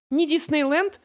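frightened question. A narrator's reading. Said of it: "испуганно спросил Беккет".

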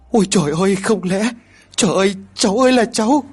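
high-pitched horrified lament; grandmother's rising, distraught wail; wailing grief. A narrator's reading. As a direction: cry out in anguish for a grandchild. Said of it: "Bà Mai khóc lăn ra cả nhà".

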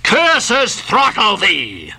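high, slow angry cry; yelled curse. A narrator's reading. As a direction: hurl the curse out, drawing each word long. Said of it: "yelled Ahab".

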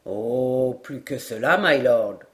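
low sergeant's voice, drawn-out opening; contradicting sergeant. A narrator's reading. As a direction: correct milord in a deep register. Sergeant contradicts Glenarvan's date.

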